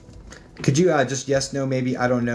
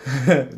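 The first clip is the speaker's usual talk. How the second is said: a quick real chuckle, louder; short laugh; 4 dB louder than usual. Real, not acted. Medium chuckling at the viewer's lol reply, real laugh. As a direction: let a laugh slip at the comment.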